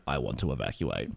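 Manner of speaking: low, quiet menacing voice; creepy Ackers. Retold or imitated. Ackers calling Strasky after months, low and ominous. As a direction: drop the voice low and sinister. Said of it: "was like".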